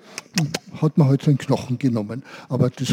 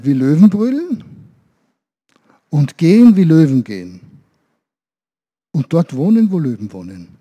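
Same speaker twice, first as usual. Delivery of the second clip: wise monkey's advice; 4 dB louder than usual. Acted. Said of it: "Und er sagte".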